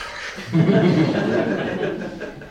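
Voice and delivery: an airy, amused chuckle; breathy chuckle. A narrator's reading. Narrator chuckles.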